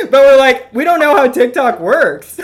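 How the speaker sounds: real laughter breaking in; cracking up mid-line. Real, not acted. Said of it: "I'm laughing at my own joke".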